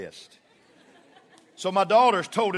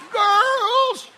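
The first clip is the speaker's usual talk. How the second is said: very high, louder falsetto wail; wife's peak yell; 6 dB louder than usual. Retold or imitated. Wife calling girls at her peak, high falsetto wail. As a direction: imitate her hardest try at yelling, comically high. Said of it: "That's her peak".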